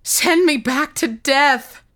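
high, slow, desperate cry; anguished scream. A narrator's reading. Revived boy begs the bishop for death, screaming. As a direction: scream the plea, stretching the words. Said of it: "screamed the boy".

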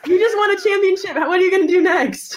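loud, high mock post-game shout; excited interviewer. Co-host playfully interviewing a fan who just won, excited shouting. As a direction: shout it like a hyped-up sideline reporter.

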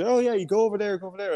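high-pitched put-on local giving directions; helpful passerby. Passerby to lost driver, high helpful voice.

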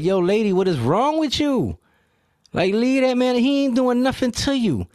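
imitated high-pitched outcry; high, exasperated bystander impression; bystanders intervening. Acts bystanders jumping in between.

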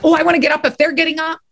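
high, very quick eager aside. Acted. Improviser watching classmates get up, eager.

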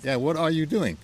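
mock-probing repeat of a question; insistent questioner.

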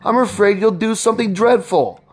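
high-pitched anxious wife; fearful, beseeching appeal to a husband; frightened pleading. A narrator's reading.

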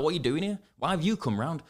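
retold exasperated challenge; losing temper. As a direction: demand an explanation, re-enacted. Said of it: "I lost my rag a little".

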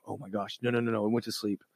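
real dismay as the recorder dies. Real, not acted. Recording device stops mid-vlog.

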